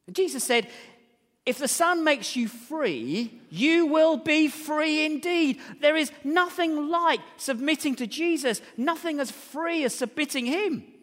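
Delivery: high, loud, drawn-out proclamation; emphatic preaching. Real, not acted. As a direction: proclaim scripture with weight on every word. Proclaims scripture with force.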